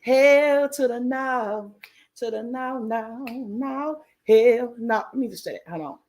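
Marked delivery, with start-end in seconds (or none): 0.0-5.0 slow, drawn-out higher no; emphatic refusal. Real, not acted. Host asked about sailing Virgin again, slow sassy hell no. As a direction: stretch it out with sass.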